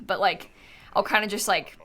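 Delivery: ordinary delivery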